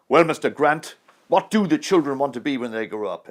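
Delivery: high, loud line delivered full out. Retold or imitated. Actor delivering his scene line to Kirk Douglas, loudly. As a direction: boom the question like a megaphone man. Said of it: "That voice, so loud".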